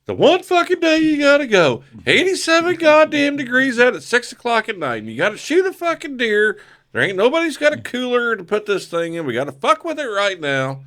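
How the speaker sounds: hammed-up grumpy dad complaining nonstop; bitching dad rant. Dad griping at son about the hot deer hunt, mock rant. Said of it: "I'm bitching the entire way".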